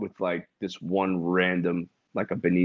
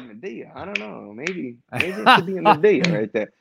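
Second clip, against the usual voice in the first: mock pondering; 7 semitones higher than usual. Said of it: "some people are kinda, like, thinking about it".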